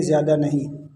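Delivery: ordinary talk, nothing marked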